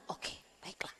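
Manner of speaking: whispered, meek giving in; resigned whisper. Retold or imitated. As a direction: whisper it like you've just been told off. Resigned reply after being corrected. (bisikan pasrah)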